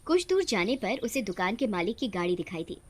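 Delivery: usual speaking voice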